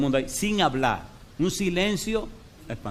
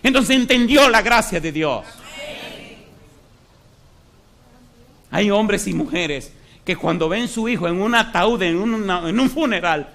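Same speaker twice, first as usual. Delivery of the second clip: alto y fuerte, lento, clamando; predicación a plena fuerza; 6 semitones higher, 7 dB louder than usual. Acted. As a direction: clama a la congregación como un predicador encendido. (predicador encendido ante la congregación, clamando)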